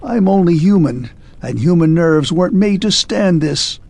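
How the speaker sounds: a rattled man begging for mercy; shaken pleading. A narrator's reading. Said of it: "shivering from head to foot".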